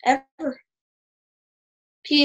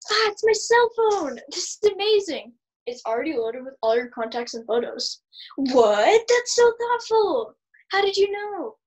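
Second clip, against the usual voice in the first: gushing; 5 semitones higher than usual. Acted. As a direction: gush over the present. Gushing over own gift.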